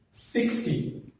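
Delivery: "Sixty" stressed on SIX; stress at beginning. Shown as a demonstration. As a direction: lean on the first syllable of "Sixty". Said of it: "Stress is on the beginning".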